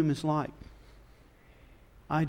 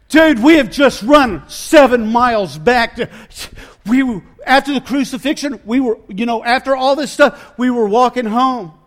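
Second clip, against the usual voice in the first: loud, higher, gasping out the news after a long run; breathless shouting; 5 semitones higher, 10 dB louder than usual. Retold or imitated. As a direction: shout it as if out of breath from running miles. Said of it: "They're panting. They're out of breath".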